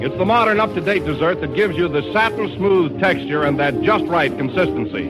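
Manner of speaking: ordinary delivery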